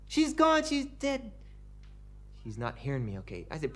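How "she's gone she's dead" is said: mock wailing. Professor keeps wailing.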